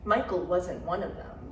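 usual speaking voice

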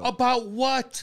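high, slow, drawn-out question; flustered indignation. Retold or imitated. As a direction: stretch the question out in acted disbelief. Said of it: "I was flustered".

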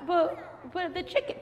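sputter, then insist; stammered protest. Acted. Storyteller acts a protest over the chicken, sputtering. Old woman's order interrupts man's protest.

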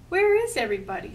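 high, fast puzzled cry. Acted. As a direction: call out baffled. Little Witch alone in graveyard.

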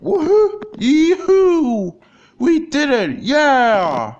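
high, loud whooping celebration; victory cheer. Acted. Super Tyler and friends celebrating the rescue, cheering loudly. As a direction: cheer like you just won.

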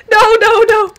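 high, very loud panicked scream; freaking out. Real, not acted. Gamer screaming at a frozen game, freaking out. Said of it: "Sorry for freaking out there".

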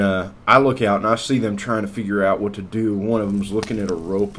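ordinary delivery

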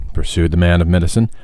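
usual speaking voice

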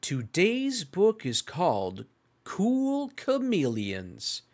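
bright, lively storytime read; picture-book reading voice for young listeners; reading to children. Acted. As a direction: read it like storytime for little ones. Reads picture book to kids.

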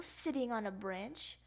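ordinary delivery

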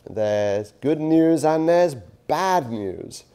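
slow, deliberate doctor voice; measured joke-telling doctor; doctor's slow setup. Acted. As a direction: draw it out like a grave doctor. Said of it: "the doctor says".

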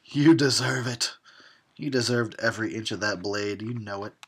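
ordinary talk, nothing marked

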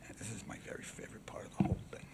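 ordinary talk

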